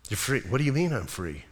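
soft, low, questioning murmur; mildly acting a stunned prisoner; hushed disbelief. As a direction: ask it quietly, hardly believing it. Barabbas acted hearing he is freed.

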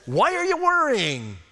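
high, loud, pleading question; Jesus asking. Retold or imitated. Preacher voicing Jesus to worried people, a high loud plea. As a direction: rise up, exasperated and caring. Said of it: "What Jesus said".

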